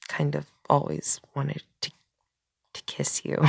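soft, trailing into a near-whisper; hushed, halting admission of a crush; shy confession. Acted. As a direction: confess it hesitantly, voice dropping away.

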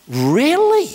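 high-pitched, amazed question; mock astonished double-check; incredulous. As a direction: ask it high, hardly believing it. Acts his disbelief at healing.

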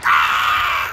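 very high, loud screams; panicked screaming. Real, not acted. Gamer dying on the fake beach, panicked shrieks. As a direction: shriek like you are dying.

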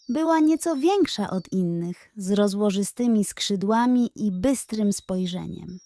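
usual speaking voice

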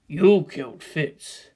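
ordinary delivery

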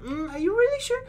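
very high, teasing mock voice; high-pitched mock questioning; nagging game. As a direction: needle in a squeaky, doubting voice. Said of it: "And then the game's like".

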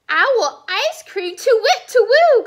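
hooting owl, rising loud at the end; startled storybook owl; alarmed owl. Acted. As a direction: build from dismay to loud hoots. Owl alarmed at being food.

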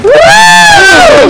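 high, loud whoop; long, high-pitched cheer; excited cheer. Acted. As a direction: whoop for your team. Players cheer team announcement.

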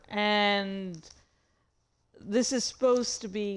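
ordinary talk

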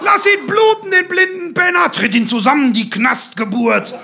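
high, loud rabid shout, easing towards the end; frenzied shouted tirade; hysterical yelling. A narrator's reading. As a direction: scream for blood from the touchline. Hysterical shouting match of fathers.

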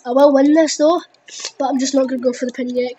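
ordinary delivery

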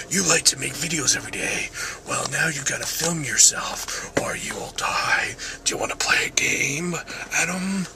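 soft, whispery villain impression; Jigsaw. Villain threatening Adam from New Age Video, creepy whisper. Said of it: "sounds like Jigsaw".

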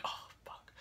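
soft unvoiced whisper of inner dismay; whispered thought. Thinking to herself about the vomiting dog, a soft whisper. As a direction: breathe it out under your breath, unheard. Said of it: "in my head, I'm like".

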